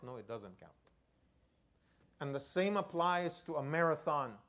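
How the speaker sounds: ordinary talk, nothing marked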